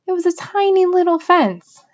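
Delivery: mocking echo. A narrator's reading.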